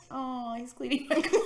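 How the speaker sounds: hammed-up dopey drunk remark; mock drunk. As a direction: drawl it out drunkenly, amused. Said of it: "sitting in the car drunk".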